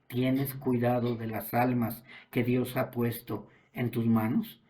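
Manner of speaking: ordinary talk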